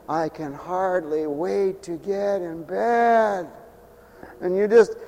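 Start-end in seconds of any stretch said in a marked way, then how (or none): 0.1-3.5 slow, soft, weary mumble; tired sigh. Retold or imitated. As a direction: drag it out as if too worn out to move. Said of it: "when you're tired and you say".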